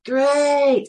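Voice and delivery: high, loud playful call; excited greeting. Retold or imitated. Teller greeting the boy, high and bright call.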